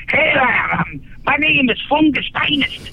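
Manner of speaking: a hint of a broad Scottish stranger; Scottish accent. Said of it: "with a heavy Scottish accent and says".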